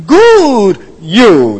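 "Good" stretched and punched, then a firm "you"; emphatic. Shown as a demonstration. Preacher giving the literal Hebrew line, emphatic stress on "Good". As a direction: hit both words of "Good you" separately, falling at the end. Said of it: "That's emphatic".

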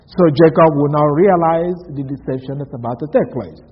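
ordinary delivery

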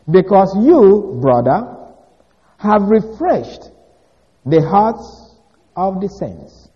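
slow, measured Bible reading; scripture reading. Shown as a demonstration. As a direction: read it out slowly like holy text. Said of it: "Verse seven says".

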